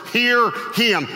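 HEAR and HIM each punched, high; emphatic command. Retold or imitated. God adds two words for Peter, emphatic command. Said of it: "this time he adds two words".

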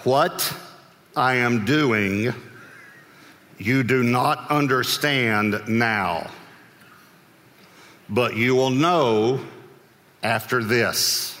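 very slow, word by word, long pauses; measured, as if holding back exasperation; strained patience. Acted. Jesus answers interrupting Peter, strained patience. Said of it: "this is the way he said it".

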